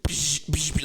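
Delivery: quick hissing zap noises; imitated laser blasts. Comic mimicking beams shot off the stage, sharp pshes. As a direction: fire off beam sounds with the mouth. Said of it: "and just, like".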